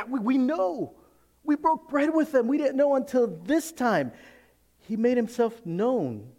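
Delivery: very high, loud, eager insistence; thrilled eyewitness voice, slowing at the end; excited disciples. Acted. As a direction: burst out with the news like an excited disciple. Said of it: "These disciples are excited".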